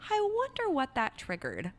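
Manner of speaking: high, singsong curiosity; lightly playful self-inquiry, high-pitched; playful wondering. Shown as a demonstration. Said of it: "with this degree of playfulness".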